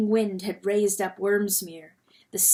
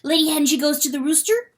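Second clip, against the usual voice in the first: high, fast weasel question; eager weasel; 6 semitones higher, 6 dB louder, faster than usual. Acted. Weasel John asking Lady Hen, quick and high. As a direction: ask quickly, hopeful.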